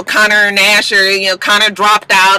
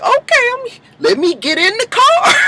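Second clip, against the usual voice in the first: much higher, breathless swoon; giddy. Pretend. Host imagining obeying Nate's order, giddy high squeal. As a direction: pretend to hop in eagerly.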